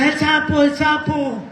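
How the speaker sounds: high-pitched mock alarm, moderate volume; imitated frightened cry. Mother alerting her son to a toad, imitated frightened cry. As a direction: play mom crying out over a toad. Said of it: "mi mamá estaba gritando".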